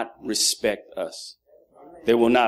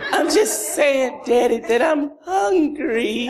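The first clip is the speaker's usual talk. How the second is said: put-on slow, higher little boy pleading through a laugh; mock kid voice; 12 semitones higher, slower than usual. Son answering his father at bedtime, slow high laughing voice. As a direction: play the five-year-old pleading sweetly.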